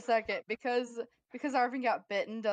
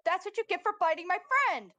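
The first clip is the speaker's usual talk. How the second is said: high-pitched scolding screech; indignant yell; 9 semitones higher, faster than usual. Acted. Player scolding zombie that bit a friend, yelling. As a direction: yell it up at the monster. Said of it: "'cause you just yelled that".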